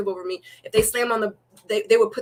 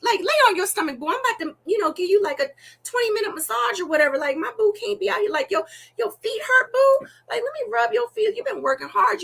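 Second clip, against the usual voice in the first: put-on high doting boyfriend; 12 semitones higher than usual. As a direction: fuss over her like a doting boyfriend. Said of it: "my man would be like".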